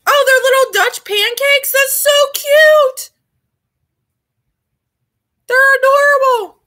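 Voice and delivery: cooing. Real, not acted. Finds pictures of tiny pancakes.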